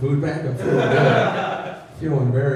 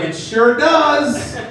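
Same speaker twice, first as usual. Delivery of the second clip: smug; 9 semitones higher, much slower than usual. Retold or imitated. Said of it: "And he's like".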